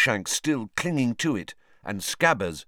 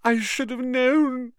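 high, slow tragic lament; 3 dB louder than usual. Acted. Lavender lamenting her rabbit to the class. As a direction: mourn it theatrically. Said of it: "said Lavender tragically".

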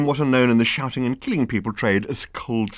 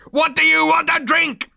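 very high, loud shrieked question; officer screaming at prisoners; 8 dB louder than usual. Acted. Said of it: "Number two screamed".

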